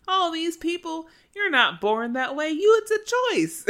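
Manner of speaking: high, loud mock drunken ranting; shrill-voiced caricature of a drunk mother ranting at TV; drunk mom rant. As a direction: parody a tipsy mom yelling at the TV. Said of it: "drunkenly ramble at the T V".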